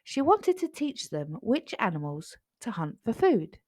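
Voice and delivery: plain reading voice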